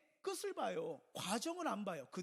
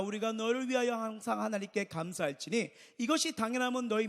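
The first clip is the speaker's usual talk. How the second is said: loud, ringing reading of Bible verses; scripture reading; 8 dB louder than usual. Acted. Pastor reads Paul's verses aloud to the church, loud and ringing. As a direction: proclaim the verses to the congregation.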